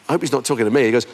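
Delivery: normal talking voice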